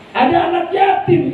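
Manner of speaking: fiery preaching. Acted. Preaching at full force.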